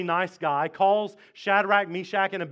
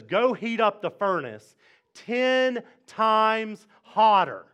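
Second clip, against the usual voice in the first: slow, forceful royal order, louder on the last word; furious king; 3 semitones higher, 4 dB louder, slower than usual. Acted. King orders his servant to heat the furnace, furious. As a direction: bark a furious command, drawing it out. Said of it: "the king gets furious".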